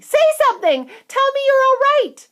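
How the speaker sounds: very high, loud pleading; frantic cry. A narrator's reading. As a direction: beg him to answer, panicking. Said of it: "cried Missus Teavee".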